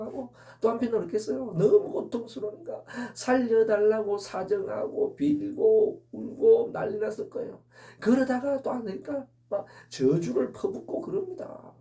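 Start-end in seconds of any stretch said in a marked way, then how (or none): none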